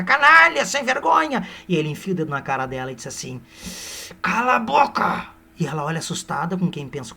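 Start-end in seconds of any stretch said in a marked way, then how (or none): 0.0-1.5 very high, loud insult; hammed-up furious yell. Aurélia jumping up at Seixas, high loud mock fury. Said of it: "feita uma jogatirica". 4.2-5.3 high, slow, put-on bark; mock stern command. Seixas pointing at Aurélia, slow mock angry order.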